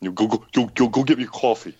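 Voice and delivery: a loose, hammed-up New York accent bossing Dad around; a kid imitating a New York accent, badly; mock New York accent. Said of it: "You're doing it totally wrong".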